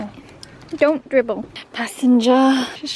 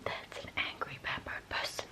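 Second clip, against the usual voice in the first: hushed whisper; 22 dB quieter than usual. Real, not acted. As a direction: whisper so the cat stays calm. Whispers near cat.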